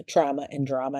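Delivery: normal talking voice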